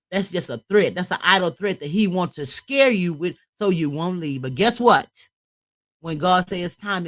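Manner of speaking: loud, urgent preaching straight to the listener; preaching at full force. Real, not acted. Urging abused women in the chat to reach out, preaching loud. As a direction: lift her up, preach it out with conviction.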